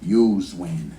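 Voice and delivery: very low, drawled ad voice; commercial line. Retold or imitated. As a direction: drop way down for the punchline. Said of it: "It went like this".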